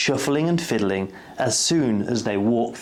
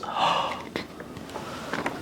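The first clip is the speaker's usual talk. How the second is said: gasp; 9 dB quieter than usual. A narrator's reading. Story ending twist.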